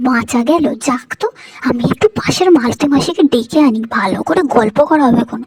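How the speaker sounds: loud, gloating aside to herself; loud gleeful grumble. Acted. Daughter-in-law glad mother-in-law left, loud gloating aside. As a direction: crow loudly that the old lady is gone.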